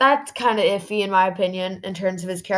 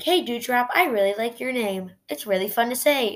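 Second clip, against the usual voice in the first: higher, lilting quoted voice; lightly put-on higher voice for a retold compliment; imitating Alexa; 5 semitones higher than usual. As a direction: play Alexa's part a little higher. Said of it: "She's like".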